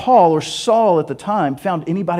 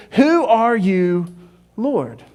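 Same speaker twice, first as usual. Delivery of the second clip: awed slow question; 4 semitones higher, much slower than usual. Acted. Fallen Saul asks the voice.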